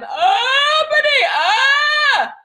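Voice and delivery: very high, loud, slow stretched yell; yawning belt, drawn right out; open belt yell. Shown as a demonstration. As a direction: expand into a big open belt. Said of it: "see how I'm expanding into".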